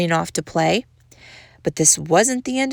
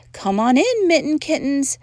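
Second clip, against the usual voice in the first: mama calling the kittens in; 6 semitones higher than usual. A narrator's reading. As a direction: call out lightly, pitched up. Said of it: "called out Mama Junebug Jones".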